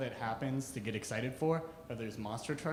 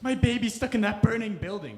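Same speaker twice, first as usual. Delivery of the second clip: panicked mother; 8 semitones higher, 6 dB louder than usual. Retold or imitated. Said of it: "a mother would be like".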